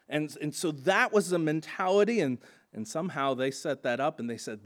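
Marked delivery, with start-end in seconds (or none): none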